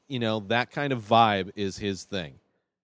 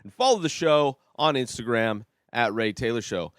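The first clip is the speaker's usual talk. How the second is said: fast, louder sign-off ad read, bright and pushy; upbeat outro promo read; 4 semitones higher, 4 dB louder than usual. Acted. Host plugging his show to listeners, fast promo read. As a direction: rattle through the plugs like a radio promo.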